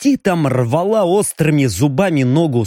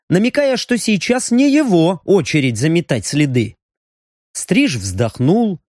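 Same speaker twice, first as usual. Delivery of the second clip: usual speaking voice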